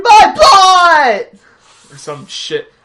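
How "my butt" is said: high, loud cartoon screech; mock scream. Mocking both characters' scream in a loud, high screech. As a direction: shriek it like a hammed-up panic. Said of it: "they both scream".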